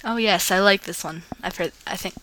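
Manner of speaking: plain reading voice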